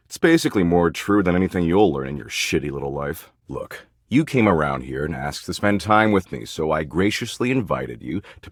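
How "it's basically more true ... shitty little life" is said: usual speaking voice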